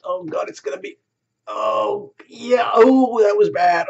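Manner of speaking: high, cracking cry of dismay, breaking to a whisper at first; mock anguished wail. Moviegoer crying out at a disaster on screen, high and loud.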